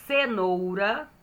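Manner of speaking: "cenoura" slowly drawn out; syllable by syllable. Shown as a demonstration. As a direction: stretch each syllable of "cenoura". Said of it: "Falei sílaba por sílaba".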